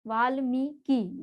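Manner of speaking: Valmiki in slow separate syllables; careful demonstration. Shown as a demonstration. Teacher showing the real spelling, Valmiki slowly sounded out. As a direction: draw out मी in Valmiki. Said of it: "वो क्या है वास्तव में".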